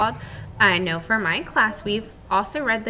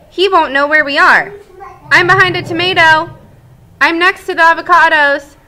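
high, loud, giddy party voices; excited eggs; 8 semitones higher, 14 dB louder than usual. Acted. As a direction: bounce like kids at play. Eggs playing hide and seek.

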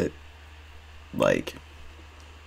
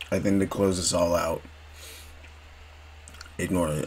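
talking around ice in mouth; words muffled by ice cubes; 5 dB quieter than usual. Real, not acted. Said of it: "I just have ice in my mouth".

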